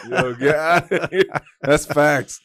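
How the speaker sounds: words through laughter; laughing hard while talking. Real, not acted. Laughing at the impression.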